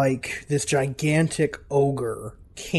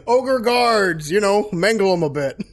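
louder, breezy order to the guards; casual ogre king command; 6 semitones higher, 8 dB louder than usual. Acted. Ogre king casually orders guards to beat the party. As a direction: toss the order off lazily.

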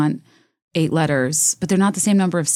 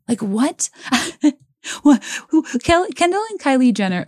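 high, disbelieving squeak of shock; can't believe the Jenners wrote a novel; incredulous; 8 semitones higher than usual. Real, not acted. Podcaster reacting to the Jenner novel, disbelieving high squeal.

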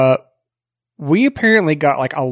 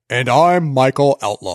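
imitating announcer voice; 2 semitones lower than usual. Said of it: "It sounded like you totally changed".

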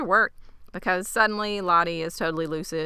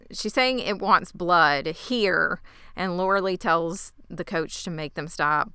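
normal talking voice

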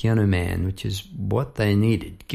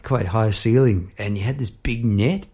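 ordinary delivery

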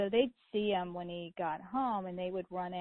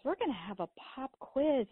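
excited teacher mom; 6 semitones higher than usual. Retold or imitated. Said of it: "I would say to the kids".

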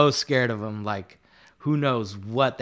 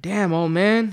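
retold amazement; 7 semitones higher than usual. Retold banter with drunk inmate.

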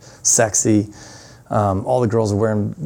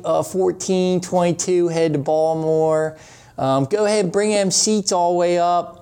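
Baltimore accent, like a friendly cabin announcement; 6 semitones higher than usual. Shown as a demonstration. To passengers on a flight to Baltimore, in a Baltimore accent. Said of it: "I'd do my Baltimore accent".

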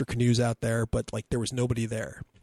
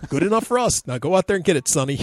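put-on higher, louder breezy order to the kid; buddy's bossy voice; 6 semitones higher, 5 dB louder, faster than usual. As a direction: Pete waves the kid off, unbothered. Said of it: "And Pete's like".

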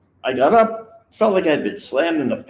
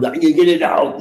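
put-on louder Austrian-accented action-hero voice; Arnold Schwarzenegger impression; 2 semitones higher, 5 dB louder than usual. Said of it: "Total Recall with Arnold Schwarzenegger".

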